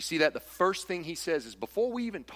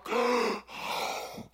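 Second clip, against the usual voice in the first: shocked gasp; 7 semitones higher than usual. Retold or imitated. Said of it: "And they're like".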